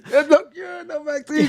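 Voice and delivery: mock the doomed professor; shrill mock despair; despairing scientist. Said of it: "She was like".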